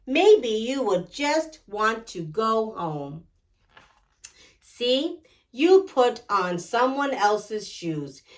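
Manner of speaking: ordinary delivery, nothing marked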